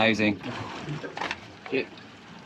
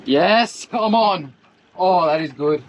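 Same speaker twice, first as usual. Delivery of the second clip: excited; 5 semitones higher, slower than usual. Real, not acted. Fish hooked, excited shout.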